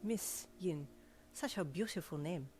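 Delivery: put-on gentle French-accented compliment; charming French valet. A narrator's reading. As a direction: savour the name in a French accent. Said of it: "his accent making your name".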